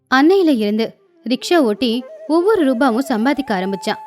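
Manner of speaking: usual speaking voice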